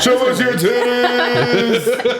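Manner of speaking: high loud laughing yell; laughing shout. Real, not acted. Drunk joke, loud laughter.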